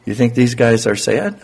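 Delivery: ordinary delivery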